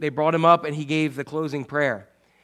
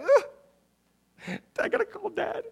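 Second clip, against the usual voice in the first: put-on much higher, whiny complaining kid; reluctant groan; 4 dB quieter, much slower than usual. Kid dreading a phone call to dad, whiny and high. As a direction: groan it like a chore you dread.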